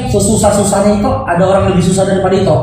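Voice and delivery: low, fast mocking parrot; a low quick send-up of her sermon. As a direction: rattle it off low, teasing. Mocking repeat of her line.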